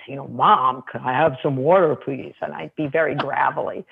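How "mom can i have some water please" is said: deep voice. Retold or imitated. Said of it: "in your deepest voice".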